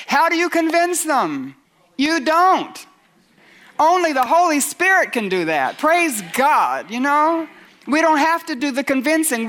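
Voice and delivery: high, emphatic preacher's delivery; forceful preaching. Acted. Preacher telling believers who does the convincing, forceful preaching.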